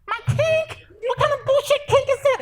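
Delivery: incredulous, thin falsetto; hammed-up high, strangled outrage; squeaky pained disbelief. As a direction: squeal the question as if still in pain. Pained man answers in squeaky voice.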